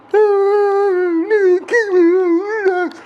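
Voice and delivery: very high, loud, slow wailing sob; hammed-up kid crying to his brother; mock crying. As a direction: blubber it out like a bawling little kid. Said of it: "crying like a motherfucker".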